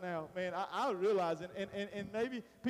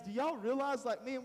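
ordinary talk, nothing marked

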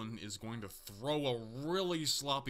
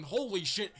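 stunned exclamation; 6 semitones higher, 3 dB louder than usual. Real, not acted. Huge move by the wrestler.

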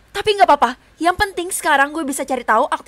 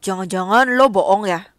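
deep, teasing guy; low boy voice; 4 semitones lower than usual. Acted. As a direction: drop the pitch to play Rehan.

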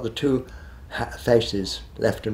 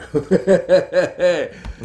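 laugh; 9 semitones higher, 10 dB louder than usual. Real, not acted. Laughs after recalling his wish.

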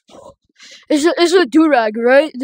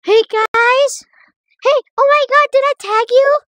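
excited; 12 semitones higher, 4 dB louder than usual. Real, not acted. Excited shouting in game.

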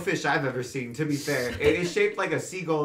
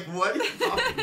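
imitating Simon; faster than usual. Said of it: "And even Simon's like".

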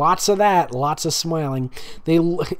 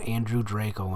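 ordinary delivery

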